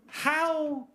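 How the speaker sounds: high, halting, incredulous question; mock bewilderment, slowly spelled out; baffled questioning. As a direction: ask in broken phrases, pausing in disbelief. Baffled question to dealer, acted out.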